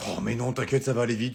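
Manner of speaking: ordinary delivery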